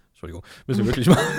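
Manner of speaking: rushed, laughing words after swallowing wrong; half choking, blurting it out fast; choking on a drink. Real, not acted. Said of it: "Ich hab mich verschluckt gerade".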